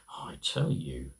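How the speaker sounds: confiding whispered aside. Acted. Narrator confiding in the listeners about Nana, whispering.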